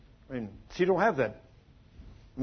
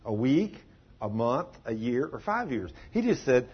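ordinary delivery

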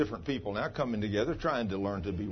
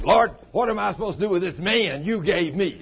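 loud, higher complaining at God; mock fussing; 7 semitones higher, 7 dB louder than usual. Pastor imitates wife Cheryl complaining to God, loud mock fuss. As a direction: fuss at the Lord like a fed-up wife. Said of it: "she was fussing".

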